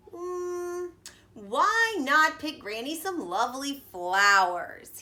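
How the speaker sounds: 1 sly wolf. A narrator's reading. Wolf sweet-talking.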